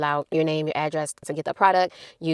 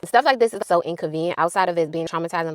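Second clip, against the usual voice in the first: usual speaking voice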